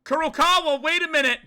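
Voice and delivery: high, loud cry to stop; urgent shout. Acted. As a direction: call out sharply to make someone wait. Game character calls out, later "screaming.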